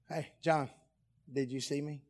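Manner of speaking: soft, confidential aside; hushed bragging. Acted. Peter privately boasting to John next morning, hushed. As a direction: say it quietly, for one friend.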